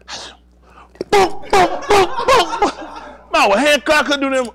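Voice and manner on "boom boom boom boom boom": high, punchy "boom" sound effects; imitated thudding blows. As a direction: act out a rain of blows in booms.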